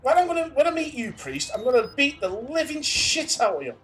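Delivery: loud, high angry threat. Real, not acted. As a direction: rant threats at the screen. Threatening the game's priest.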